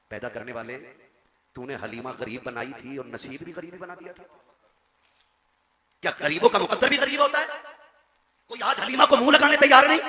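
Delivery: high, loud wailing prayer to God; tearful plea. Acted. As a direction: cry out to heaven in despair. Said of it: "रो के कहा था".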